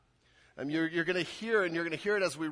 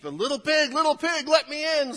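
high, loud big bad wolf voice; 11 semitones higher, 7 dB louder than usual. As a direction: call out sweetly as the sneaky wolf. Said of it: "said the wolf".

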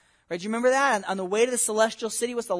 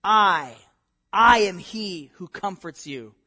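strong stress on "I", louder and slow; emphatic; 6 dB louder, slower than usual. Acted. Yahweh answers his people, emphatically stressing "I". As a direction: hit "I" hard, then slow and firm.

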